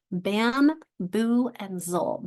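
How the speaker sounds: bamboozle split into bam, boo, zul; teacher chunking bam boo zul; syllable by syllable. Shown as a demonstration. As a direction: sound out bam, boo, zul as separate beats. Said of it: "My word is bamboozle".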